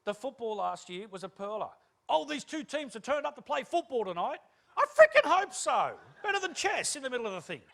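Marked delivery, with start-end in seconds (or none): 2.1-4.4 high, loud commentator call; sports commentator. Retold or imitated. Football commentator stating the obvious on television, excited call. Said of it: "sports commentators". 4.7-7.6 very loud, high outburst; exasperated heckle. Acted. Viewer answering a silly football commentator, exasperated shout. Mocks the commentator's obvious remark.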